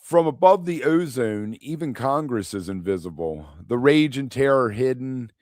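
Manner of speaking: measured poem recital, slightly lifted pitch; poetry reading. Acted. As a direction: read it as verse, phrase by phrase, pausing between lines. Reads the next poem.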